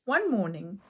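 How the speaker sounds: ordinary delivery, nothing marked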